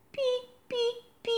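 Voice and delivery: tiny, very high chick peeps; chick peeping. A narrator's reading. Little chick peeping nearby, high squeaky chirps.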